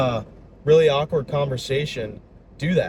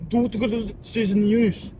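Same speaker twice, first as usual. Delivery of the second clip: high, drawn-out mock-Russian babble; Russian accent; 8 semitones higher, much slower than usual. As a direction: imitate the teacher's slow, fake-Russian outburst. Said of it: "In this Russian accent".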